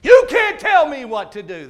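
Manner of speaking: loud, forceful order; commanding shout. Retold or imitated. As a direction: bark it down at her. Commanding possessed girl, her defiance.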